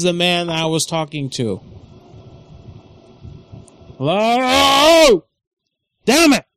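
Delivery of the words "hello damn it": higher, louder frustrated hello; fed-up caller shouting into the phone; exasperated shout. Real, not acted. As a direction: yell into a dead line. Put on hold, frustrated.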